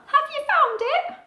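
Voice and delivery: high, hopeful owlet question; high little owl voice. Acted. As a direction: ask the mouse hopefully. Owlet asks the grasshopper.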